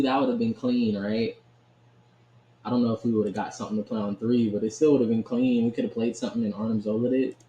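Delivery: normal talking voice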